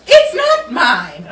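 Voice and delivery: slow, loud, emphatic denial. Acted. Betty insists to Rachel the bottle isn't hers, loud slow denial. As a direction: drag it out to insist.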